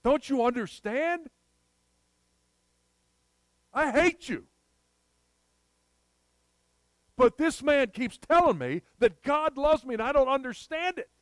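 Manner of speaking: high, loud gang leader's outburst; preacher voicing Nicky yelling at the gangs; retold yell. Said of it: "yelled at everybody".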